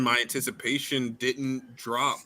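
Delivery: usual speaking voice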